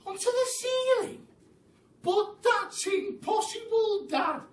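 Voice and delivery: very high, shocked little voice; startled small monkey. Acted. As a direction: protest to Dad in disbelief. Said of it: "cried one of the small monkeys".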